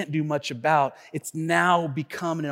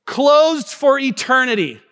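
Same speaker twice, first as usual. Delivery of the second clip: mock despair; 8 semitones higher, 6 dB louder, slower than usual. Finding bathroom closed.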